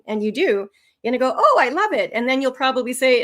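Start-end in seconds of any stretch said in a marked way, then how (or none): 1.4-2.1 high, louder burst of keenness; bright-eyed acted enthusiasm, pitched well up; enthusiastic reply. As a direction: act out loving the idea.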